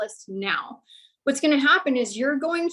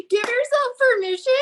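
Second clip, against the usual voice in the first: excited; 11 semitones higher, 4 dB louder than usual. Real, not acted. Repeating louder, excited.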